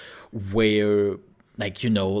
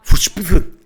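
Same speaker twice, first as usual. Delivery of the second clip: higher, much louder lisped Fußspuren; lisping guard; 9 semitones higher, 13 dB louder than usual. Retold or imitated. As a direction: lisp the S's of Fußspuren. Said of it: "He was like".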